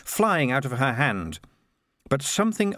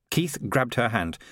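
ordinary delivery, nothing marked

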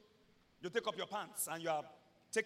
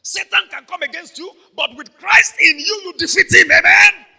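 loud, high call to the congregation; rousing shout; 9 semitones higher, 27 dB louder than usual. Real, not acted. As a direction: whip the crowd up, shouting.

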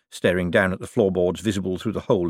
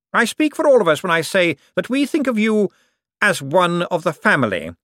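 high, loud, grandly sincere voice; unctuous Cosmo; 11 semitones higher, 5 dB louder than usual. Acted. Cosmo calls Bent one of the family, unctuously. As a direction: proclaim it with oily emphasis.

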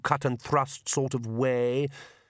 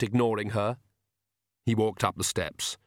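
plain reading voice

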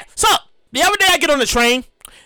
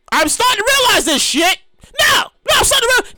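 exasperated outburst; 7 semitones higher than usual. Real, not acted. Exasperated rant about the train.

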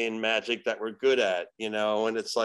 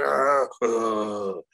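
mock demon growl; 3 semitones lower than usual. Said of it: "I'm like".

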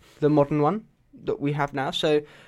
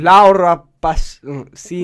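Spanish accent; 12 dB louder, slower than usual. Shown as a demonstration. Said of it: "I'm just doing a Spanish accent".

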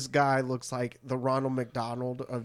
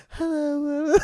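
put-on high falsetto girly hello; girl voice; 3 dB louder than usual. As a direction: squeak it like a fake girl. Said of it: "then he does the girl voice".

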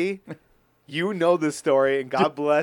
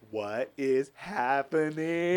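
bewildered disbelief; 3 dB quieter, much slower than usual. Retold or imitated. Stunned while she accuses him.

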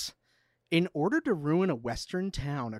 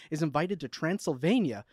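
ordinary talk

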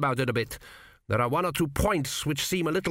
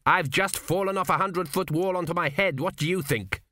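mild shout; 7 semitones higher than usual. A narrator's reading. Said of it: "shouted the king".

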